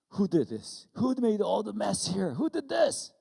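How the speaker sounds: re-enacted scolding, building to a shout; angry interrogation. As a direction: grill the kid like an angry dad. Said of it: "I was so angry".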